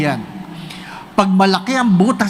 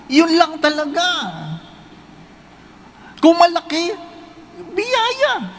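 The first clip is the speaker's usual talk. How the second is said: ordinary delivery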